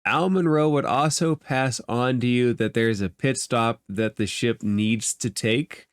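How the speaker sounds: normal talking voice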